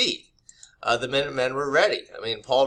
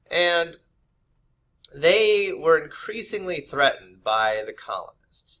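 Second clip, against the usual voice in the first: ordinary delivery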